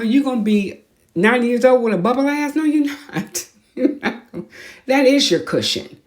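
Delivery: ordinary talk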